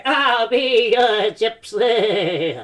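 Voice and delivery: slow hammed-up [name] croon; [name] impression. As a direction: drag it out like a bad [name]. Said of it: "that's my [name]".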